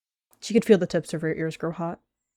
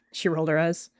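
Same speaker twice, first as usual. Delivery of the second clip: plain reading voice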